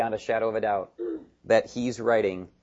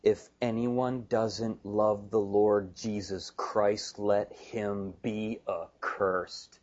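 very slow, softer, lower reading; grave emphasis; 3 semitones lower, 4 dB quieter, much slower than usual. Retold or imitated. Preacher reads Paul's curse to the church, slow and grave. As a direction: weigh each word of the curse slowly and quietly. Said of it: "are to be emphatic".

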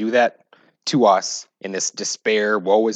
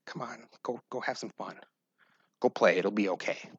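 put-on much softer, coaxing a sulking child; gentle chiding; 9 dB quieter than usual. Loving parent gently coaxing a pouting child, much softer. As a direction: softly nudge the child to go play. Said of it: "the parent who loves them kinda chides them".

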